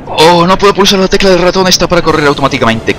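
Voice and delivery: high-pitched plaintive whine; mock-distressed gamer moan; whining complaint. Real, not acted. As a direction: complain in one long high stream. Complains about missing key.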